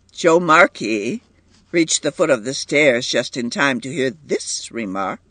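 usual speaking voice

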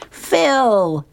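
high delighted cry of a name; excited call. Acted. Luann spotting Phil, calls his name in high delight. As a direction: squeal the name out with joy.

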